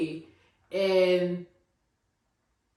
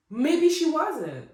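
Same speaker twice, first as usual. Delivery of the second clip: higher, drawn-out doubtful maybe; unconvinced; 7 semitones higher, 5 dB louder, slower than usual. Real, not acted. Vlogger doubting her own defense of Robin, slow and unconvinced. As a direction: say it like you don't buy it. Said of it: "I don't even believe myself when I say it".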